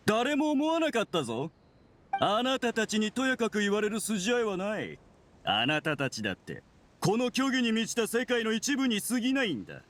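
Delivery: defiant and unrepentant; defiant outburst. Acted. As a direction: argue back hotly, pitch pushed up. Villain defends himself against accusers.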